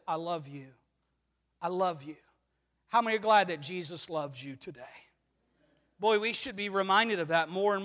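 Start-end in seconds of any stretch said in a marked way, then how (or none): 0.1-2.2 hushed, low and loving; tender reassurance. Acted. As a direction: softly comfort someone who has failed. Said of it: "he comes alongside and he says".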